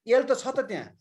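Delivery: ordinary talk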